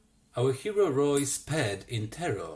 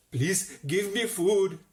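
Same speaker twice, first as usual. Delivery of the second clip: pleading cry; 6 semitones higher, 5 dB louder than usual. Acted. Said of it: "the creature cried".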